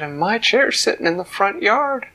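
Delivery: a mother appealing to Papa; high alarmed mama voice; distressed Mama Bear. Acted. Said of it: "Mama said".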